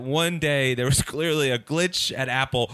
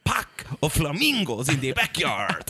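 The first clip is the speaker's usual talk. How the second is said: mock [name] accent; 3 semitones lower than usual. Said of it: "do the voice".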